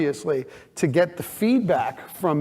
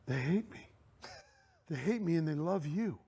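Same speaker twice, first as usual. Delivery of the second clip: brooding; 12 dB quieter than usual. Retold or imitated. Upset co-star confiding in a castmate, brooding. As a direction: imitate a sulking man, quiet and heavy with pauses. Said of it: "he was brooding".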